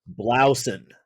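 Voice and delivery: "Blausen" with "blou" rhyming with now; Americanized French word. Shown as a demonstration. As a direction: say "Blausen" the American way, BLAU-sen. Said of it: "I have been pronouncing this French word".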